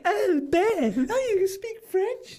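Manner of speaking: very high falsetto impression of his missus; hammed-up falsetto admiration; swooning missus. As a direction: squeal it as an impressed partner in a mock girly voice. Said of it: "and she'd be like".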